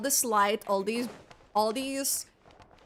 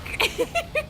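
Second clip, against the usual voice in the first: high giggle. Real, not acted. Laughs hopping on bike.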